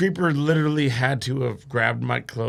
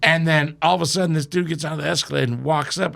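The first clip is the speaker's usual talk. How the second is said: ordinary talk, nothing marked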